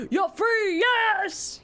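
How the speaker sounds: high, loud cheer of joy. Real, not acted. Celebrating a viewer done with boring training, a loud joyful cheer. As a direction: shout it out like they escaped.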